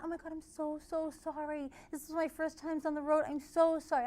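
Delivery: put-on nervous teen apologizing to the angry driver; flustered apology. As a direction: plead like a scared new driver. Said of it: "I was like".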